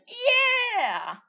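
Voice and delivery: high, loud, drawn-out yeah; long, loud victory yell; excited cheer. Real, not acted. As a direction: whoop it out slowly. Cheering the explosion.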